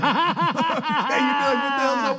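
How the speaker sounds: drawn-out squeaky mock disgust; slow high mock outrage. To the friend, a slow high mocking outrage. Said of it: "you'd be like".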